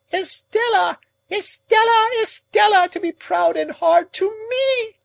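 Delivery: very high, loud anguished shriek with a cry. A narrator's reading. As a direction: scream her name in despair. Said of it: "Miss Havisham quite shrieked".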